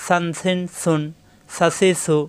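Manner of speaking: "সান, সিন, সুন" with a soft lisped th; tongue tip lightly at the teeth for each "সা, সি, সু"; gentle dental hiss opening each syllable; soft tongue-tip sound. Shown as a demonstration. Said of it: "জিহ্বার আগা দাঁতের আগায় নরম করে".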